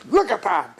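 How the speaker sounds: furious. Retold or imitated. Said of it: "And he was really furious".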